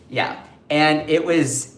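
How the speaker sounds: usual speaking voice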